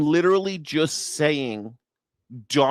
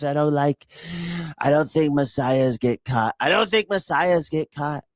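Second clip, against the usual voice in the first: mock whiny self-pity, pitched up at first, sagging toward usual; crying, pouting Trump; 3 semitones higher than usual. Host mocking the president's speech as a poor victim, mock pouting. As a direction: play a pouting, wronged politician begging for sympathy. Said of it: "crying and pouting on a stage".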